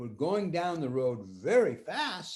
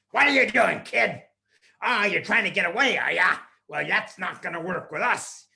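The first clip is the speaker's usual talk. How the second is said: loud, higher, sneering; menacing clown; 4 semitones higher, 8 dB louder than usual. Acted. Clown catching the boy escaping, loud menacing voice. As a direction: catch him in the act.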